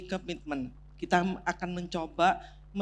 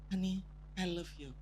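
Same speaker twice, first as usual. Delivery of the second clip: soft honey I love you; 10 dB quieter than usual. Retold or imitated. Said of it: "kalau mendengarkan suami mengatakan".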